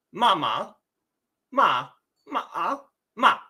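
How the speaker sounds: ma, ma, ma, ma, ma with distinct rising and falling tones; 声调练习; Mandarin tone sentence. Shown as a demonstration. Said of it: "this question would sound like".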